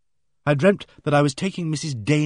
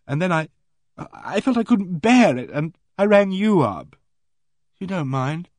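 anxious; 7 semitones higher, 3 dB louder than usual. Acted. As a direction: ask timidly whether he minds. Frightened girl asks for reassurance.

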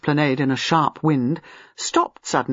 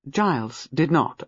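plain reading voice

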